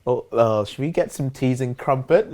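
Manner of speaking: mock British accent. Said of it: "American people are like".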